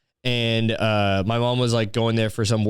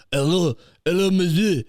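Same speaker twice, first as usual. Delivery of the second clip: high-pitched mock French accent; mock French greeting; 7 semitones higher than usual. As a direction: do an exaggerated French shop greeter. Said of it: "somebody greets you in fucking French".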